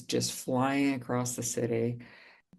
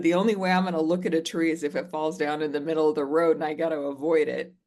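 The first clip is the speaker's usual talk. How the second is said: fast, louder sarcastic rant; retold rant; 3 semitones higher, 4 dB louder, faster than usual. Mocking her mother's tree advice, a fast, louder sarcastic rant. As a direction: rattle it off, scornful and fed up. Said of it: "I flipped out".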